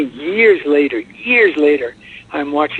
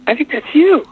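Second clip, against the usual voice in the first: imitating daughter; 13 semitones higher than usual. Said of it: "She goes".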